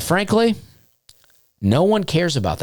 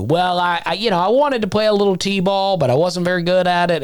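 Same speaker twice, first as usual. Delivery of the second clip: put-on high, nervous rambling child voice, faster at the end; over-explaining kid; 9 semitones higher than usual. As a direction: babble an excuse in a squeaky kid voice. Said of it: "I was probably about to get into".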